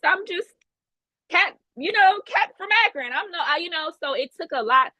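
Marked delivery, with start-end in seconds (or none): 0.0-3.9 pitched up and self-deprecating, Cat from Akron; mock small voice. Speaker mocking her own doubt, squeaky and small. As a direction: shrink yourself into a nobody.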